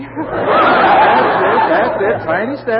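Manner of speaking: high, loud, laughing encouragement; excited laughing cheer. Acted. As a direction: cheer her first steps with laughter. Cheering her first steps.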